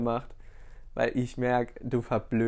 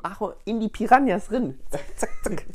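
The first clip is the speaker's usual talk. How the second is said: silly high voice; 9 semitones higher, 4 dB louder than usual. Pretend. Mock fish voice.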